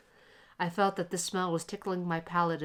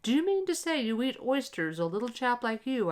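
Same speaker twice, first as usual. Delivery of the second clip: higher, mocking gentleman's voice; amused gentleman; 7 semitones higher, 3 dB louder than usual. A narrator's reading. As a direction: tease the little boy, amused. Amused gentleman teases the boy.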